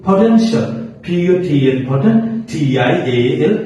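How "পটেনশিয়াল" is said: পটেনশিয়াল high, as "po-TEN-shal", rising at the end; pronunciation example. Shown as a demonstration. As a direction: model পটেনশিয়াল with a lifted ending. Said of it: "প্রথমটা হতে পারে কি?". (পটেনশিয়াল উঁচু স্বরে, উচ্চারণ দেখিয়ে)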